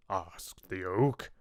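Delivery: plain reading voice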